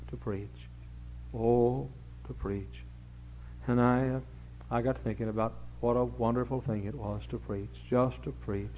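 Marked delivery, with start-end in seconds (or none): none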